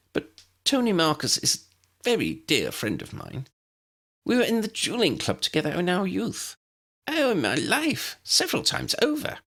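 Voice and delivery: put-on high, squeaky tiny old wizard; squeaky little professor. A narrator's reading. Tiny professor answers the head teacher, high squeaky voice.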